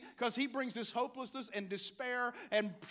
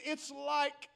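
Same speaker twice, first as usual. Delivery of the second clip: usual speaking voice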